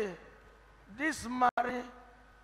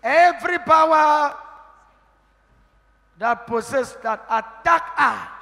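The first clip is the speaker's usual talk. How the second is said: loud commanding prayer, higher; hollering revival preacher; 5 semitones higher, 12 dB louder than usual. Acted. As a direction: declare it with force over her. Deliverance prayer.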